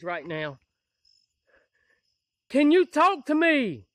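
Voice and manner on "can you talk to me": slow, loud call into the dark; calling out to an unseen spirit in the woods. Real, not acted.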